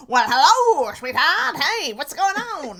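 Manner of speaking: put-on loud, drawling old-movie wise guy; high trousers voice. Shown as a demonstration. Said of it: "High trousers is".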